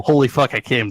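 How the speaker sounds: ordinary talk, nothing marked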